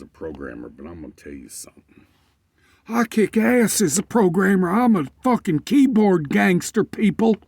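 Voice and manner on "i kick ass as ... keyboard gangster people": much higher, louder boast; bragging shout. Real, not acted. Boasting to listeners about coding, loud proud shout.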